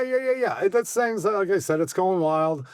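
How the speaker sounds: usual speaking voice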